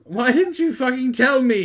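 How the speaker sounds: high, loud hammed-up shout; mock shout. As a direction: yell it like a wounded emo. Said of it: "he shouted".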